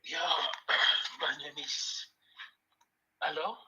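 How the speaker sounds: faint, barely audible voice; speaking too softly on the phone; quiet. Real, not acted. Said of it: "Speak up, please".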